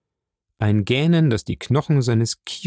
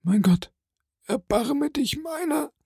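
dying plea. A narrator's reading. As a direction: beg for mercy while crying. Dying man begs God.

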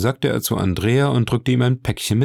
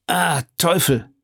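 exclaiming; 9 semitones higher than usual. A narrator's reading. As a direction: curse as if caught on a sore spot. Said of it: "rief Andrea". (gedehnter, hoher Ausruf)

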